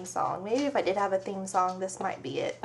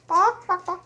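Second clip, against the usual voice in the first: high, loud playful woof woof; mock dog barks; 8 semitones higher, 5 dB louder than usual. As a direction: bark like a little dog to coax the chicken. Mimicking dog for chicken.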